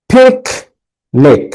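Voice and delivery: Picnic split, "pik" high and punched, "nik" dropping; syllable stress. Shown as a demonstration. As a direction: punch the first syllable of picnic, pause, then the second. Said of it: "একটা করে হাততালি দিবে".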